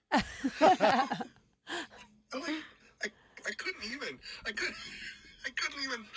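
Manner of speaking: high, squeaky words through laughter; helpless laughter. Real, not acted. Storyteller cracks up at the punchline, laughing helplessly. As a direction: lose your voice laughing as you talk.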